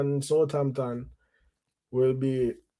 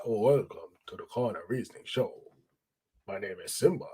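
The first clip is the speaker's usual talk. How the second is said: lower, softer, laid-back intro; low calm voice; 6 semitones lower, 8 dB quieter than usual. Shown as a demonstration. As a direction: say it low and relaxed, not announcer. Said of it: "I sound like a radio announcer".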